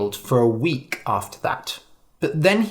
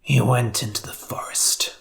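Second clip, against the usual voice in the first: whisper; 2 semitones lower, 3 dB quieter than usual. A narrator's reading. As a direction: hush it so the wolf won't hear. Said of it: "said the hunter".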